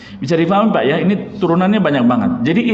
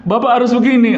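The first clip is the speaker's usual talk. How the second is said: bossy demand; 7 semitones higher than usual. Retold or imitated. Subordinate ordering boss.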